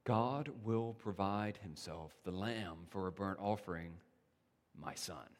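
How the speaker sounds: hushed father. Acted. Abraham answering his son.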